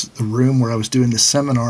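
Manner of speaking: usual speaking voice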